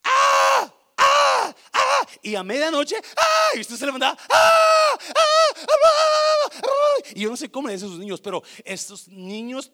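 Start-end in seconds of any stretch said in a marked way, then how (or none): 0.0-2.1 put-on very high, loud shrieking baby cries; imitated newborn screams; baby wailing. 3.2-3.5 put-on very high, loud shrieking baby cries; imitated newborn screams; baby wailing. 4.3-6.7 put-on very high, loud shrieking baby cries; imitated newborn screams; baby wailing.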